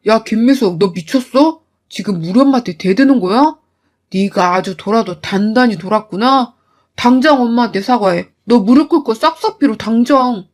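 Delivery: a husband barking orders at his wife; angry husband. Acted. 남편이 아내에게 호통침. (낮고 큰 목소리로 호통치는 남편)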